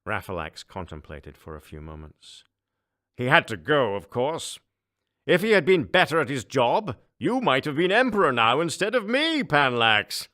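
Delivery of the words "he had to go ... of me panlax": higher, louder gloating ruler, laughing at the end; a smug tyrant enjoying the joke; gloating emperor. Acted. Emperor jokes.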